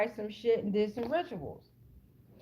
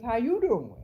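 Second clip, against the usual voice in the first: Wendy Williams impression. Reader greeting viewers like the talk show host, lightly imitated. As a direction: toss out the famous greeting like the host. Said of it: "Like Wendy Williams".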